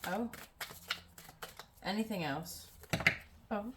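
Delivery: ordinary talk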